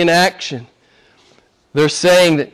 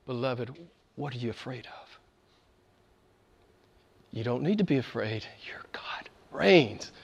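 tender pastoral whisper; soft reassurance; 5 semitones lower, 14 dB quieter than usual. Acted. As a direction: whisper comfort to the fearful. Comforting the afraid congregation.

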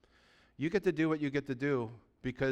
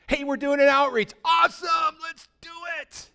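much higher, louder excited shout; eager volunteer; 9 dB louder than usual. Acted. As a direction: jump at the chance, thrilled. Acting an eager church member.

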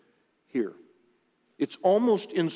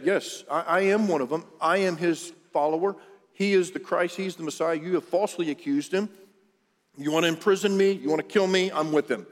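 raised, emphatic declaration of allegiance; bold defiance; 5 semitones higher than usual. Acted. Peter owning up to his accusers as Jesus's follower, boldly defiant. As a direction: stand up to accusers, voice lifted.

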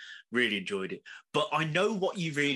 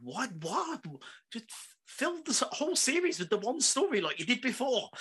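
high baffled protest; 7 semitones higher than usual. Retold or imitated. Fan retelling his reaction to the Chinese plot, high baffled protest. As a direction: squeak it out, fed up with the twist. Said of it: "I was like".

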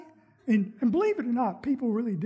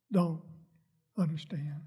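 ordinary delivery

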